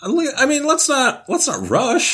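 high-pitched playful protest; high teasing voice. Pretend. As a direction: lift the voice way up, teasing.

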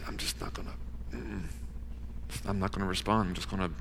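breathy, soft stubborn refusal; half-asleep mumbling of a hardened heart; stubborn whisper. Acted. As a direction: murmur it like you won't wake up. Hardened sleeper refusing to respond.

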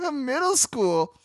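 high, slow, mocking echo; mocking repeat. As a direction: drawl it back with a scoff. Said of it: "her response was this".

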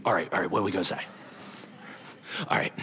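low, soft conspiring; huddled whisper. Retold or imitated. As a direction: plot quietly in a huddle. Pharisees huddle like Family Feud.

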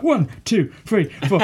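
high, excited countdown impression; Dave Benson Phillips. Kids' show host counting out a challenge, excitable. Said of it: "when he used to count the thing".

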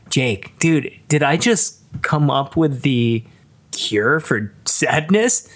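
ordinary delivery

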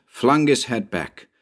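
plain reading voice

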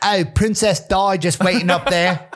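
put-on high, cheeky British accent. Imagining Princess Di waiting in heaven, British accent. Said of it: "I can't do accents like that".

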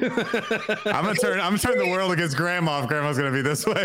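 high, laughing through it; can't keep a straight face. Real, not acted. Laughing threat at grandma.

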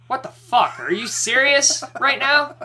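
high, loud exasperated cry; loud incredulous what-the; disbelieving outburst. Retold or imitated. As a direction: yell it in total disbelief. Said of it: "threw their arms up and said".

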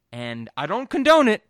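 ordinary talk

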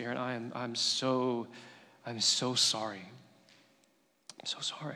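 soft, halting condolence; retold sorrowful apology. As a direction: offer a hushed, helpless apology to a grieving sister. Retold apology to grieving sister.

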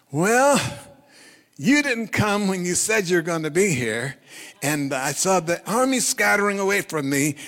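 high, loud self-justifying protest; defensive excuse. Acted. Saul excusing himself to Samuel, loudly defensive.

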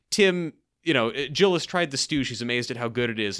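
ordinary talk

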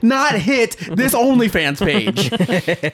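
loud, high, drawn-out mock disbelief; incredulous outrage. Comedian as subscriber baffled by the price, incredulous mock outrage. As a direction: cry out slowly in hammed-up indignation.

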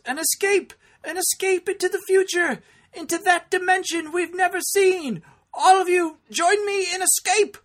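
shrill, blaring, overacted dub villain; overacted anime dub. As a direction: ham it up like a bad English dub. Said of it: "in the dub version... the English dub, he says".

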